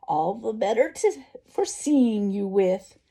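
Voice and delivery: slow, wobbly wolf posing as grandma. A narrator's reading. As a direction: draw it out with a wobble. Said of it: "replied in the same wobbly voice".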